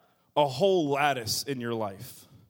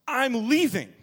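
storming off; 8 semitones higher, 6 dB louder than usual. Acted. Churchgoer storming off.

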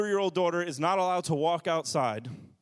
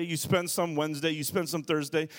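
ordinary talk

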